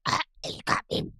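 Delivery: breathy whispered defeat; whispered line. Retold or imitated. Cohost plays a beaten character, whispering. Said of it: "Or like this".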